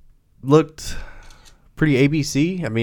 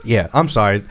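usual speaking voice

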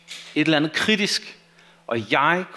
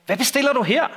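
higher-pitched scolding question; mildly indignant, pitched up; 7 semitones higher, faster than usual. A narrator's reading. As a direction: read it as a brother telling someone off. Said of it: "skældte han".

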